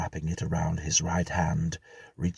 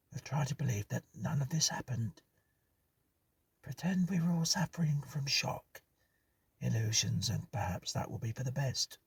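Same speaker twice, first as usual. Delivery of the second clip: higher, softer, half-whispered; hushed tone; 5 semitones higher, 3 dB quieter than usual. Acted. Hughes to the others after the ghost, hushed. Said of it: "Hughes spoke in a hushed tone".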